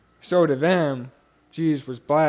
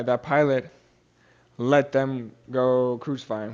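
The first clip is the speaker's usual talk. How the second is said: usual speaking voice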